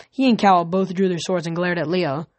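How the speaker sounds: usual speaking voice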